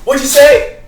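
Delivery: loud, high-pitched teacher challenge; stern teacher outburst. Retold or imitated. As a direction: call the student out sharply and loudly. Teacher confronting student over cursing.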